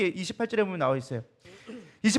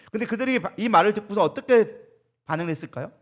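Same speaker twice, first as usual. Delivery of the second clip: normal talking voice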